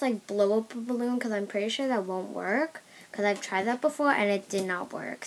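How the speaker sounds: ordinary delivery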